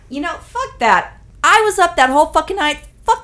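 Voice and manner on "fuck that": usual speaking voice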